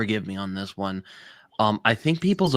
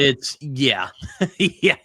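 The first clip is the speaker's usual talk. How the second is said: laughing through the words; awkward giggling yeah; nervous laugh; 5 semitones higher, 4 dB louder, much slower than usual. Real, not acted. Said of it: "I don't mean to laugh".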